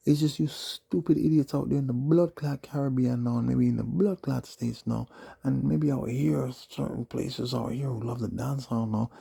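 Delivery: loud, high-pitched ranting tirade; angry rant. Real, not acted. Ranting at dancehall fans, a loud high angry tirade. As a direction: raise your voice and pitch, railing hard.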